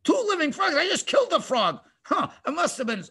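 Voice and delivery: high, baffled disbelief; astonished Pharaoh. Retold or imitated. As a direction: gasp out the disbelief.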